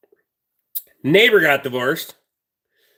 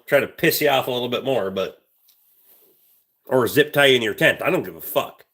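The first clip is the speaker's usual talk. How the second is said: normal talking voice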